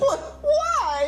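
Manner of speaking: very high, stricken yelp; shocked squeal. Acted. Son hearing he may be given away, shocked squeal. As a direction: squeak it out in dismay.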